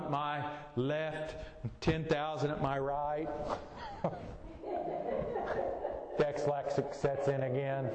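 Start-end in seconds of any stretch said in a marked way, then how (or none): 3.3-6.1 breathy laugh. Real, not acted. Laughs at own misreading. 6.2-7.9 laughing. Real, not acted. Laughing at own slip.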